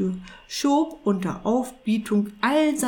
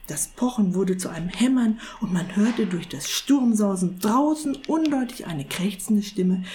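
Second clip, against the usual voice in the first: usual speaking voice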